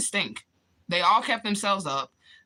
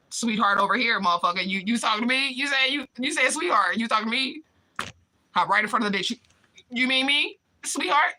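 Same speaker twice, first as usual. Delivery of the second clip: mock jealous confrontation; 6 semitones higher than usual.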